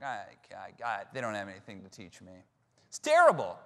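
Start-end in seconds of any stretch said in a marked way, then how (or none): 0.0-2.3 muttered under the breath, much softer and lower; smug inner thought. Retold or imitated. Student sizing up professors, muttering smugly to himself. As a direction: quietly dismiss the professors to yourself. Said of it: "just being like".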